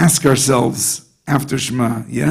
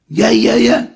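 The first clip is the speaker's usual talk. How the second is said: much higher, breathless mock panic; frantic brother; 7 semitones higher, 3 dB louder than usual. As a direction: squeal it out like a brother acting frantic. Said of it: "the guy's screaming".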